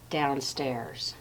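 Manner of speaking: soft and far away, calling from below; distant soft voice. Acted. The dark calls Laszlo from the basement, soft and distant. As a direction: make it sound like it comes from the basement. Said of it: "the voice seemed very far away".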